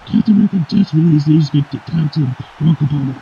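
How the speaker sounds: ordinary talk, nothing marked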